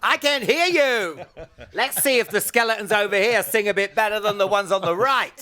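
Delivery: high, loud crowd-hyping call; mock stadium frontman shout. Singer goading skeletons in the catacombs, mock concert shout. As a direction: work the crowd like a rock singer. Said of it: "holding out the microphone going".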